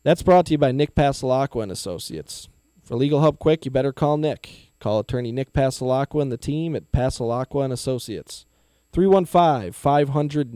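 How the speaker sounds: upbeat radio ad read. Acted. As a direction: sell it like a local radio spot. Reads sponsor ad.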